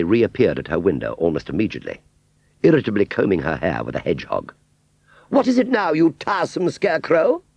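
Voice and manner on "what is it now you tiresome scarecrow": very high, loud exasperated snap; irritable Aunt Sally. A narrator's reading. Aunt Sally irritably answering Wurzel, very high snap. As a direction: snap at the tiresome scarecrow.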